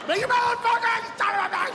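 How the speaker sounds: high enraged scream; road rage. Retold or imitated. Young driver cut off on freeway, screaming furious.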